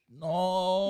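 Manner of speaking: long, high mock "no"; mock wail. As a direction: draw it out high in mock dismay. Acting out reaction to stolen camera.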